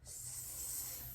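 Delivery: S hissed long and voiceless like a snake; drawn-out s like a snake. Shown as a demonstration. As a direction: stretch the S into a snake hiss. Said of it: "like a snake".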